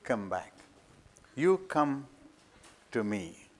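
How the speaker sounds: low, soft, calm summons; a hushed divine voice; voice of the Lord. Retold or imitated. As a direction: call him gently from far off. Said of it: "he says".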